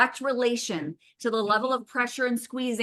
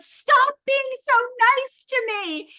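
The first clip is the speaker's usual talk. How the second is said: very high, whiny mock bride; hammed-up bride pushing him away; 4 dB louder than usual. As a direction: whine it out in a squeaky bride voice. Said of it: "And she's like".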